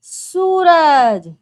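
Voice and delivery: Suraj drawn out slowly and loudly; slow clear answer. Shown as a demonstration. Teacher giving the answer slowly to the class. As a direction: say Suraj slowly for small children. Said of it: "सुबह सुबह क्या उगता है".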